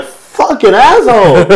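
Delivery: high, loud, slow mock cursing; mock furious curse. Man storming off cursing his ex, loud slow mock rage. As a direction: spit it out in hammed-up rage. Angry exit line, acted.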